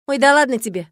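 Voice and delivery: breezy, dismissive retort. A narrator's reading. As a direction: wave the worry away. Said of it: "отмахнулся парень".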